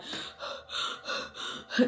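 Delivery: imitated winded panting, soft and breathy; mock heavy gasps; out-of-breath panting. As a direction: pant as if mimicking someone out of breath. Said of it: "like he was out of breath".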